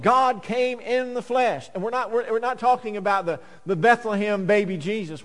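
ordinary delivery

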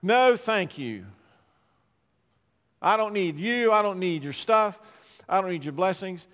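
a touch of proud dismissal; final refusal. Retold or imitated. Sinner refusing God's grace a last time, mildly defiant. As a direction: push God away, mildly defiant. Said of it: "we finally say for that last time".

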